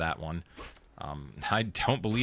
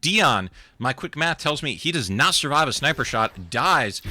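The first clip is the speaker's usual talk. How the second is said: louder, excited esports casting; live play-by-play; 5 semitones higher, 4 dB louder than usual. Acted. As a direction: call the action with rising hype.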